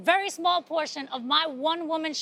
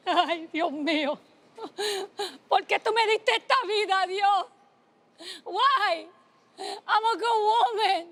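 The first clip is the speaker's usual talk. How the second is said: higher, tearful wailing to God; llorando, desesperada; mother crying; 5 semitones higher than usual. Retold or imitated. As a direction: cry out in despair, half-sobbing. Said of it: "she started crying".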